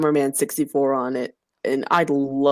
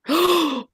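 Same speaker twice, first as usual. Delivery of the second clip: sharp gasp; 11 semitones higher than usual. Real, not acted. Sudden recall.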